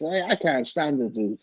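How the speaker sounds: usual speaking voice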